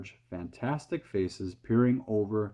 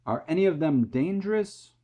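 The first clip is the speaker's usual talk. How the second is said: high, loud anxious shout; 9 semitones higher, 5 dB louder than usual. A narrator's reading. Police chief shouts up his worry, high and loud. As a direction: call up anxiously. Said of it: "shouted the chief of police".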